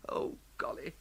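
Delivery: barely voiced whisper of dismay. Bertie alone, whispering dread about being caught. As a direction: breathe it out in a panic.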